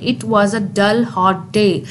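very slow, weight on dull hot day; sentence stress demo. Shown as a demonstration. Teacher showing sentence stress to students, very slow and deliberate. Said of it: "dull hot day important है".

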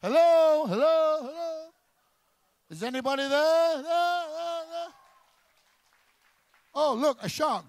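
high loud hollow echo; echoing call. Acted. Comic calling into a man's empty brain, high echoing hello.

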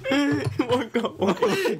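laughing. Real, not acted. Gagging, laughing at earthworm taste.

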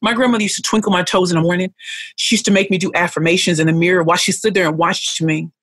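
normal talking voice

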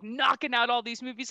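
usual speaking voice

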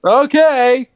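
loud okay. Real, not acted. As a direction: belt the okay out. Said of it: "say it louder".

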